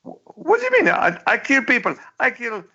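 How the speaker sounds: high, squeaky mock dictator protesting; cartoon Pol Pot voice. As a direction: squeal it like an offended cartoon tyrant. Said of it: "He says".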